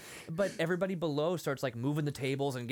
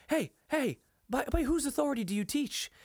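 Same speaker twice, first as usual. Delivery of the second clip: much higher mock demand for attention; retold indignant challenge; 12 semitones higher, 3 dB louder than usual. Shmuel challenging Jesus's authority to teach, mock indignation. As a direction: call out as an imitated affronted challenger.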